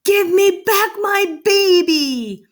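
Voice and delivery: high, loud, drawn-out holler; desperate yell. A narrator's reading. Father demanding his child from the fish, yelling. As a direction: yell it down a hole, stretching the words. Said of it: "Cheryl's father yelled down the hole".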